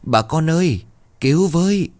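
put-on boy cries out to the whole village, pitched high; shouting for help. A narrator's reading. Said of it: "vừa dậy vừa la lớn".

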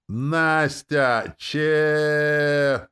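high, loud squeal with long-held vowel; ecstatic squeal. A narrator's reading. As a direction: stretch out the name like an MC. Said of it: "Ленора восторженно завизжала". (восторженный протяжный визг)

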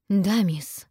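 hoarse, submissive reply. A narrator's reading. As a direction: rasp it out obediently. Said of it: "хрипло отвечает Бесси".

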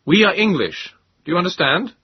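loud, high, emphatic call. A narrator's reading. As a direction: make a foreigner hear and understand. Calling to a challenging armed trooper.

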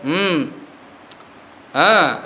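normal talking voice

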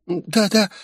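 high, small, quivering yes-yes; trembling little voice. A narrator's reading. Terrified hostage obeying the gunman, high and trembling. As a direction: answer in a tiny shaking voice. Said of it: "трясущимся голоском ответила она".

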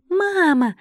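high, squeaky happy cry; joyful little creatures. A narrator's reading. Baby trolls greet their mother, high joyful cry. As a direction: call out gleefully like tiny critters. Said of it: "радостно воскликнули кочки".

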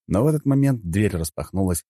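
ordinary delivery

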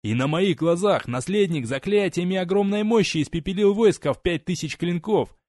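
desperate shout. Acted. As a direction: scream the truth from the gallows. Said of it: "он закричал".

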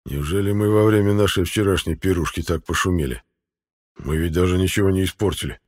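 plain reading voice

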